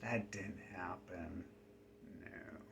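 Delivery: sarcastic whisper. Real, not acted. Sarcastic denial aside.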